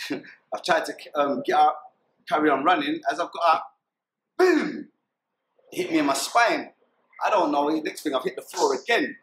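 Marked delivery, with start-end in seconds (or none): 4.4-4.9 one high, drawn-out "boom"; sudden high sound effect; imitated gunshot. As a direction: voice the bang of a shot. Imitating the shot that hit him.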